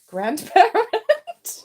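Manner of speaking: surprised. Real, not acted. Card she hoped for appears.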